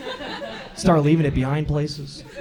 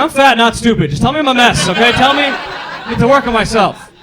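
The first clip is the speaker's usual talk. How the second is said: put-on kid's outburst; 8 semitones higher, 8 dB louder than usual. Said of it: "I was like".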